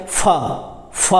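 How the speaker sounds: फ said as "fa" with friction; isolated "fa" for फ, repeated; nukta f sound. Shown as a demonstration. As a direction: say फ with teeth on lip, as f. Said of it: "नुक्ता लग गया तो".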